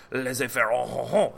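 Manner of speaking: mock French accent with "oh, oh, oh"; hammed-up French "laissez-faire". Shown as a demonstration. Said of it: "I must say with French accent".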